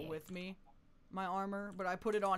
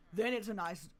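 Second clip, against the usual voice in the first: ordinary talk, nothing marked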